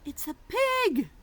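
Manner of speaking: high, loud, slow exclamation; excited reveal. Acted. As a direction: gasp out the answer with delight.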